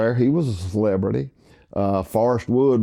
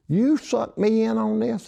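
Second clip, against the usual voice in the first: mock outraged disbelief, pitched way up; shocked disbelief; 12 semitones higher than usual. Retelling Dale's shock on the phone, high mock outrage. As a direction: retell his friend's shock at being roped in.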